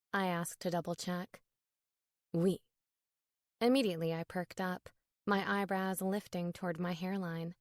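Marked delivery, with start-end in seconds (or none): 2.3-2.6 put-on French accent. A narrator's reading. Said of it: "his thick French accent".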